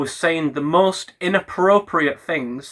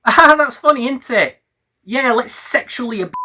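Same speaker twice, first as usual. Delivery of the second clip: sarcasm; 7 semitones higher than usual. Acted. Mocking Ethan's jokes with bitter fake cheer. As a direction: fake delight dripping with contempt.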